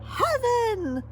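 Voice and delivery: high, loud delighted cry. A narrator's reading. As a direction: gasp at the treasure. Annabelle sees fabrics.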